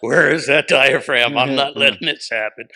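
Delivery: loud, projected acted outburst; booming mock demand. Comic replaying a diaphragm lesson, booming.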